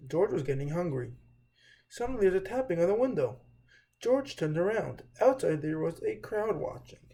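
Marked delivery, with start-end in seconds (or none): none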